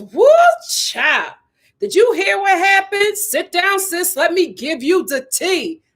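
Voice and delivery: high, slow gossip voice; sassy gossip voice. Retold or imitated. Mocking the script's sassy gossip line. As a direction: read it as a hammed-up gossip. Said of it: "it says".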